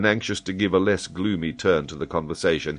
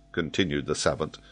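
plain reading voice

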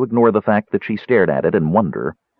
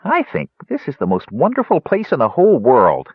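higher, louder happy exclamation; delighted; 7 semitones higher, 4 dB louder than usual. A narrator's reading. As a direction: marvel at the cozy room. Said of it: "Tass sighed in delight".

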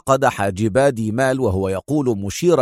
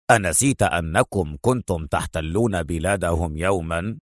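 low, relishing general's voice; savouring; 6 semitones lower than usual. A narrator's reading. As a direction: savour each word, deep and smug. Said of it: "في تلذذ وهو يقول".

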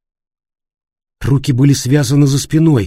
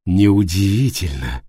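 lower, slow, eerie; suddenly sinister and cold; strange voice; 5 semitones lower, 3 dB quieter, slower than usual. Acted. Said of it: "ответила Сандра странным голосом".

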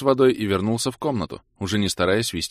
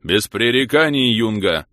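loud, slow, higher captain's bark; father's growl; 4 semitones higher, 7 dB louder, slower than usual. A narrator's reading. He imitates his father commanding Kira, loud barked order. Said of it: "рыкнул я, копируя своего отца".

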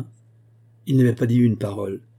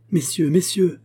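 higher, louder pleading call; cry out; 8 semitones higher, 4 dB louder, slower than usual. A narrator's reading. Narrator begging the gentlemen to spare Joseph, crying out. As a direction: call out to stop them, pleading. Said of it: "m'écriai-je".

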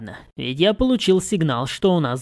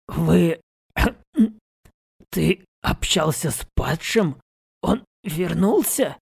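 frightened, voice nearly cracking; 2 semitones higher, slower than usual. A narrator's reading. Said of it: "Едва ли не дал петуха жрец".

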